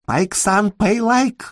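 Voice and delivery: high-pitched pleading call for money; insistent demand. Acted. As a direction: pester in a high, rising voice.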